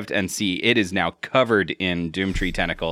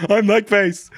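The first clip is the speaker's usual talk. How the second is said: laughing; 13 semitones higher, slower than usual. Real, not acted. Playfully claiming role, laughing.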